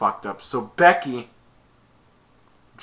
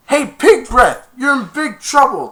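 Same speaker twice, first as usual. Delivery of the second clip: loud taunt; 11 semitones higher, 10 dB louder than usual. Retold or imitated. Zoltek taunting Murphy on the field, high loud jeer.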